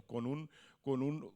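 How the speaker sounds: usual speaking voice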